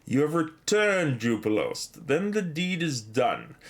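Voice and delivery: slow, solemn read of the quest giver's line. Retold or imitated. Streamer reading the quest giver's welcome aloud, slow and solemn. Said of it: "That's exactly the same as Radagast the Brown says".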